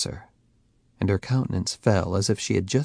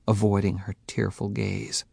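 ordinary delivery, nothing marked

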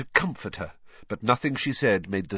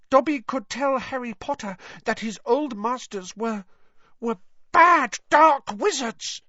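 Dobby; 13 semitones higher, 5 dB louder than usual. Acted. Elf dares denounce old masters, squeak building louder.

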